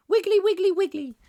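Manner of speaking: squeaky high wiggly, wiggly, wiggly; silly high squeak. Pretend. Playful squeak waving his hair tufts for viewers. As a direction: pipe it up high and silly like a toy.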